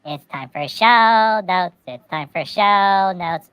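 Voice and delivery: slow, loud show announcer; slow announcer call. Acted. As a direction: call out the segment slowly.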